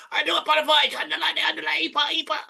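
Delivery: very high, hammed-up mock-Spanish; dodgy Spanish accent. Player mock-apologizing to Spanish viewers, dodgy Spanish accent. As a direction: squeal it in a badly faked Spanish accent. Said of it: "that's a dodgy Spanish accent".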